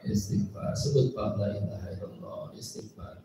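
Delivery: soft whisper into the patient's ear; whispered guidance. Shown as a demonstration. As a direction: hush it as you guide him to pray. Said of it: "langsung suruh". (bisikan lembut menuntun pasien berdzikir)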